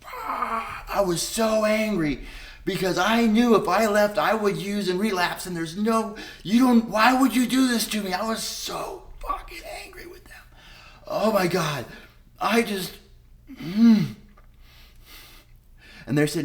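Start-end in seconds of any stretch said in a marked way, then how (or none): 0.0-0.8 anguished cry. Retold or imitated. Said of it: "and I'm like". 0.9-8.1 loud, high, near crying with rage; angry and desperate retelling; raw anger. Real, not acted. Reliving rage at being discharged. 8.1-10.4 hissed, breathless fury; seething whispered anger; angry whisper. Real, not acted. 11.1-14.2 exasperated. Real, not acted. Overwhelmed after rage.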